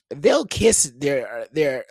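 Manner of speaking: ordinary talk, nothing marked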